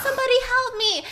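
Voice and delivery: high, pleading cry for help; imitating a panicked plea; freaking out. Mother begging for help on the phone, frantic. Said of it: "She's freaking out".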